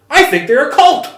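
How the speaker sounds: high, loud mocking voice; mocking the interviewee. Retelling a street interview, high mocking voice. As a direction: ham up the shirtless guy's claim. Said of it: "And he's like".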